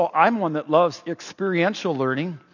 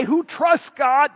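slow pointed question; 7 semitones higher, much slower than usual. Shown as a demonstration. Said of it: "if I say".

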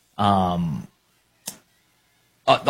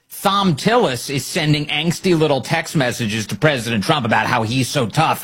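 usual speaking voice

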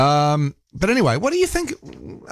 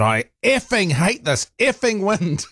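mock exclamation, pitched much higher but no louder; retold outburst; 10 semitones higher, slower than usual. Cyclist venting aloud about the wind, retold mock outburst. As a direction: re-enact an emphatic outburst with high, drawn-out words. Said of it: "Really quite loudly".

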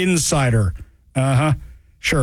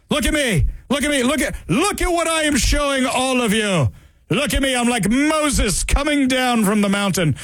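higher, showy look-at-me voice; mock attention-seeker; 6 semitones higher than usual. Mocking the protester who wants everyone to look at her. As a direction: shout it like showing off blank signs. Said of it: "she's standing there".

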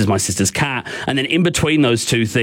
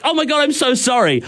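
higher, hurried mock apology; a higher, retold rush of sorry; girlfriend's apology; 12 semitones higher than usual. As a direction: play the startled girlfriend saying sorry.

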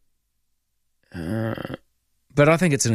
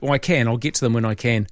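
ordinary talk

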